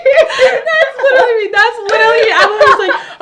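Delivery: high and loud, laughing along. Real, not acted. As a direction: laugh out the recognition. Friend laughs in recognition.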